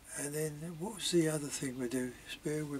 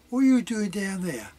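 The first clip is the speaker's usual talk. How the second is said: raised, higher shout from above; mum calling down; 6 semitones higher, 9 dB louder than usual. Retold or imitated. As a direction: call down sharply like a mum catching the kids. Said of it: "Me mum, wasn't it?".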